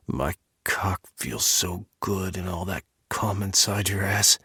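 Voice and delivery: breathy whisper right at the ear; a lover's soft whisper in the ear. Acted. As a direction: whisper it close and dirty.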